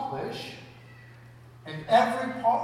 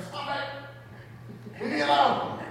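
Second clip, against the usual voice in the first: put-on higher, snapping at the interrupting kid; mock outburst; 6 semitones higher, slower than usual. Stressed parent snaps at an interrupting kid, higher mock outburst. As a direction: imitate losing it at your child. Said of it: "you're like".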